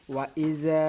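"ওয়া ইজা" with the last vowel drawn out; recitation-style "ওয়া ইজা", final vowel held; held long vowel. Shown as a demonstration. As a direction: stretch the end of "ওয়া ইজা" for one alif. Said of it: "এক আলিফ পরিমাণ আপনাকে টানতে হবে".

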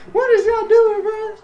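high-pitched squealed complaint; exasperated disbelief. Real, not acted. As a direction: squeal in protest at the team. Exasperated with the team.

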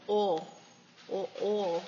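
imitated Southern drawl on "oh"; Southern accent. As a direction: say "oh" for oil like a Southerner, dropping the L. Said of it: "They got that Southern accent".

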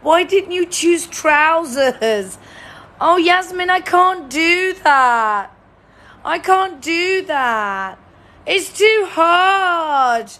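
loud, high, drawn-out wail; hammed-up moaning, each complaint dragged out; mock whining. Players complain to the host it's too hard, whining. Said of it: "moaning and whining".

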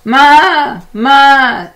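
very high, loud goat cry; goat bleat. Acted. The goat calls to the spider, high loud bleat. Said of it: "said the goat".

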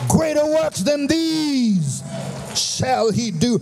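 whooping preacher building to a climax; full-force preaching. Acted. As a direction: preach it up to the rafters.

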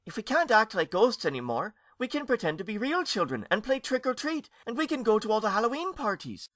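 put-on high, eager child voice; a boy bursting with a plan; young ghost pitching a fun idea, high and eager; excited boy ghost.

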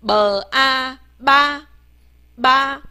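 b, a, then ba, sounded out separately and held; 拼读示范. Shown as a demonstration. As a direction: spell out "b a ba" slowly, then the joined syllable 吧. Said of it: "所以合拼起来". (慢慢拼读“b a ba”，先分开再合成“吧”)

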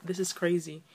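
ordinary delivery

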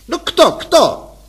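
high, loud, slow defiant demand; challenging wife. Acted. Wife challenges the passenger, high and defiant. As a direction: dare him to say it.

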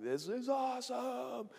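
put-on high, slow thrilled kid voice; drawn-out gleeful awesome. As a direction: savor it like a delighted kid. Kid enjoying the wave ride.

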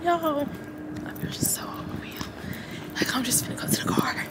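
sinks to a breathless whisper; overwhelmed whisper. Real, not acted. Student near tears telling viewers she's overwhelmed, whispering. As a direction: let it fade to a whisper, on the edge of tears. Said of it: "when I say I feel overwhelmed".

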